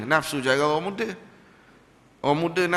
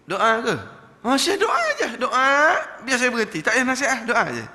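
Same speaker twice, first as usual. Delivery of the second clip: much higher and louder, laughing; laughing plea; 9 semitones higher, 6 dB louder than usual. Retold or imitated. Smoker begging for prayer instead of advice, laughing high.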